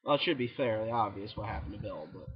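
usual speaking voice

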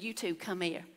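dropped low and quiet, menacing calm; a hint of a stern father, hushed; hushed God voice. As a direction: call them over in a soft stern hush. God's quiet summons.

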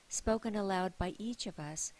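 ordinary delivery

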